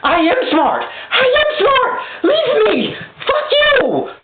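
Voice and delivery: high, strained, near-crying whine; crying high mock wail. Inner spirit of Pharaoh wailing to be left alone, high crying whine. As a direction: wail it like a panicked inner child. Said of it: "he's like this".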